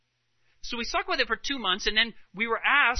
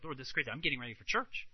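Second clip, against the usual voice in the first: soft, lower retold lament; retold tearful prayer; 6 semitones lower, 9 dB quieter, faster than usual. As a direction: quietly imitate her upset plea, without sobbing. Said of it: "then she actually started crying there".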